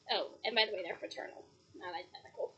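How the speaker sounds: soft unvoiced whisper; whispered aside. Real, not acted. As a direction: confide it as a secret. Whispered aside about goddaughters.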